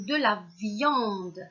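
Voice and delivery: VIANDE drawn out slowly, with nasal yan; correct-word option. Shown as a demonstration. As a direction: stretch the yan in VIANDE as a question. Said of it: "on dit de la viande". (« viande » étiré lentement, avec le son « ian »)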